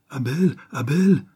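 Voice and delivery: high, slow, frightened calling of his name; frightened cry. A narrator's reading. Mother cries out to her furious son, frightened. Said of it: "cria-t-elle".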